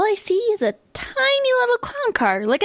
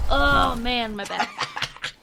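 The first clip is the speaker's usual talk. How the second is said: sore back groan; 7 semitones lower, 4 dB quieter, much slower than usual. Acted. Cramped ride, sore back.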